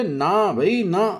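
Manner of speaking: lightly voiced shopkeeper. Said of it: "Pappu भाई बोले".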